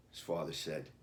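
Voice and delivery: usual speaking voice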